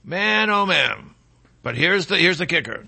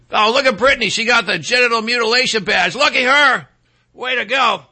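mock cheer; 4 semitones higher, 3 dB louder than usual.

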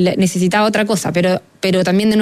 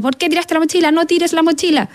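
mock scold pitched up; scolding the child; 7 semitones higher than usual. Mother scolding her son over the backpack, mock scold pitched up. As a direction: imitate a nagging parent, lightly. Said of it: "si yo le digo como".

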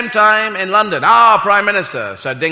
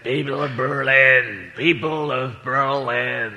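lower, slow, grand speech voice; lowered, drawn-out orator voice; mock Kennedy speech; 6 semitones lower, 4 dB quieter, much slower than usual. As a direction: declaim it slow like a president. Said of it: "and he said".

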